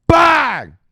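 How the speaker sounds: very high, very loud "bang"; explosive shout. Real, not acted. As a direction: fire it off like a cannon. Punctuates his hype rant.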